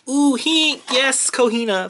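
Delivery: high, thrilled squeal at the card; excited squeal. Real, not acted. Fan spots his favourite character card, high excited squeal. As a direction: squeal with real delight.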